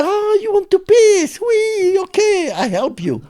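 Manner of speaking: high mocking voice, a hint of sneer; lightly voiced guard teasing. Inmate imitating the sneering guard, mildly mocking high voice. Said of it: "He said".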